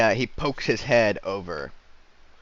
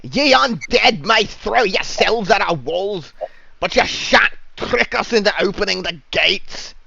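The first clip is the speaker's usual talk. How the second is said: shouting voice; 10 semitones higher, 9 dB louder than usual. Acted. Guard refusing to open the gates to suspected undead, high loud shout. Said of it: "A voice shouts back from the wall".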